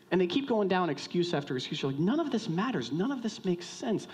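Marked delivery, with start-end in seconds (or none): 2.0-4.1 high-pitched, fed-up protest; exasperated dismissal. Acted. Wronged friend after excuse after excuse, exasperated. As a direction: wave the excuses away, exasperated.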